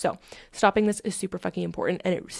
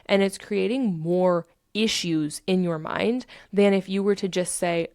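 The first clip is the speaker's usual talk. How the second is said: normal talking voice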